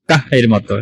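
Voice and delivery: ordinary delivery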